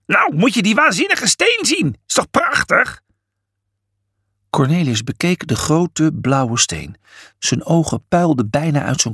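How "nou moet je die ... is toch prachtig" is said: high, thrilled showing off; excited Felix. A narrator's reading. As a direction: gush over the stone.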